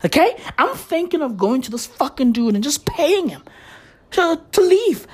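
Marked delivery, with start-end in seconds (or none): none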